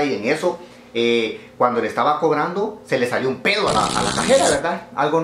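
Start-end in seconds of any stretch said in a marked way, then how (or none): none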